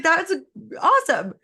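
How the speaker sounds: playfully cheering thoughts on; cheery brain talk. Pretend. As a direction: gush at your own brain like a cheerleader. Cheering her own brain on.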